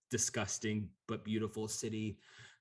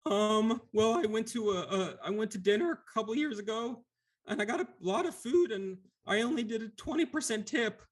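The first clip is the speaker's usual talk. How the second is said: timid Lemure; 12 semitones higher, 4 dB louder than usual. Acted. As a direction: squeak it out like a weepy blob creature. GM voices Lemure.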